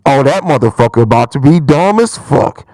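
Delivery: imitating a father scoffing at his baby; mocking dad voice. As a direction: drop way low and loud like a disgusted father. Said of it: "and said".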